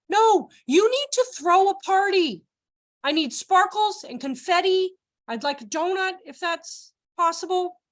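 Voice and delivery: high, emphatic mock demand; demanding child. Child wanting teacher to celebrate, mock indignant demand. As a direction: insist slowly and high like an indignant kid.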